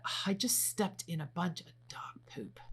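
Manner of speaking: disgusted whisper aside. Acted. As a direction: whisper it under your breath. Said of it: "It could be as simple as".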